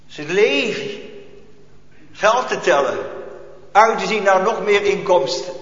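ordinary talk, nothing marked

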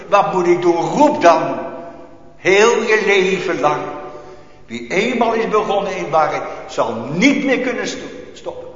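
loud, driving sermon voice; fervent preaching. Acted. Preacher urging his congregation to call out, fervent.

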